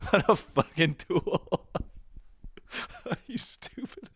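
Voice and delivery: slow insult breaking into high giggles; laughing insult. Real, not acted. Host laughs while insulting his friend, giggling jab.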